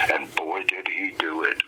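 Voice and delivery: hushed, whispered admiration; awed whisper. Real, not acted. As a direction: lean in and whisper it. Awed aside about Ming.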